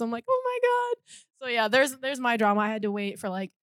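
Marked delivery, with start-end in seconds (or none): 0.3-1.1 very high mock near-tearful squeal; overwhelmed, acted out in a tiny high voice; verge of tears. Fan finally reaching the line, mock near tears. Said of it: "on the verge of tears".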